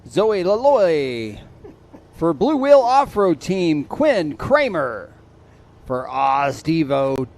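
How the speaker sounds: starting-line announcer calling riders up, loud; race announcer call-ups. Acted. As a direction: sell every name to the crowd. Reads rider call-ups over PA.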